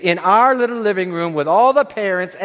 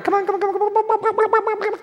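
high clucking call, come on come on; high sing-song hen call; mother hen calling; 14 semitones higher, faster than usual. Retold or imitated. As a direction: call the chicks in like a fussing hen. Hen calling her chicks.

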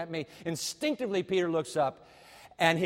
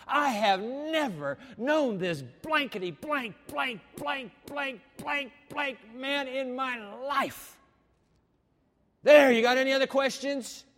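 hammed-up Peter swearing, higher and louder; a cursing fisherman's denial; mock cursing outburst; 7 semitones higher, 6 dB louder than usual. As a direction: bluster like a cornered man.

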